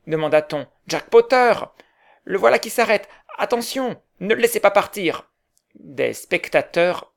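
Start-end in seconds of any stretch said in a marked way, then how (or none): 0.9-5.3 high, loud shouts of alarm; crowd shouting. A narrator's reading. Crowd shouting not to let Potter escape, high and loud. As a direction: yell a warning across the crowd.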